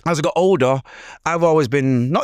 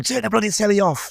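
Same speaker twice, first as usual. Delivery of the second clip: higher mock telling-off as mum; mum yelling; 9 semitones higher than usual. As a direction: bark it like an annoyed mum. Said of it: "So, so my mum".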